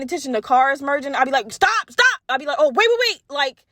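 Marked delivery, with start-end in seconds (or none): 1.5-2.2 high, urgent stop; high, louder mock panic from the passenger seat; panicked. Retelling panicking at partner's driving. 2.6-3.2 high, urgent stop; high, louder mock panic from the passenger seat; panicked. Retelling panicking at partner's driving.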